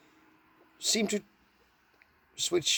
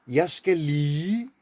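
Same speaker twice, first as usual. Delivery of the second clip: slow Danish; slower than usual. Shown as a demonstration. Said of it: "So if you slow down".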